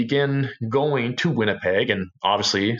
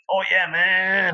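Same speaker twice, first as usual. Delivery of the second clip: higher and slow, lazily agreeing; a light stoner drawl; mock stoner reply; 10 semitones higher, slower than usual. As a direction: drag it out like a stoned friend. Friend's reply imitated.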